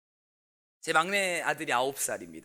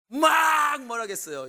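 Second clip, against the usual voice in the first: high, loud, slow burst of anger; sudden yell; 9 semitones higher, 8 dB louder, slower than usual. Retold or imitated. Pastor reliving yelling at his son, slow loud outburst. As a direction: blow up at the listener like an angry dad.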